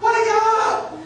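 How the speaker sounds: angry corner yell; trainer yelling. Retold or imitated. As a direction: shout to jolt the fighter. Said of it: "The guy's like".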